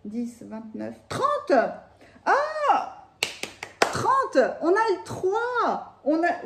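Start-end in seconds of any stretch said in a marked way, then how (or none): 1.1-6.4 high, thrilled exclamations; excited. Real, not acted. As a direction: squeal with delight at the count. Excited counting the tarot cards. (tout excitée)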